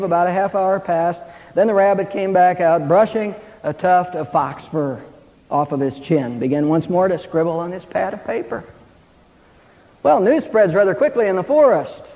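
ordinary delivery

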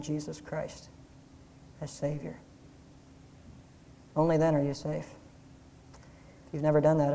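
soft, low, earnest invitation to prayer; hushed closing appeal; quiet altar call. Acted.

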